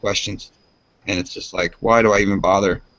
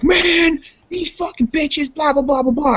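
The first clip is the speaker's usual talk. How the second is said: very high-pitched imitation of a furious friend; mock angry rant; slower than usual. Adam storming into the apartment, mock angry rant. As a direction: rant like a worked-up buddy, pitched way up. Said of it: "he was mad as hell".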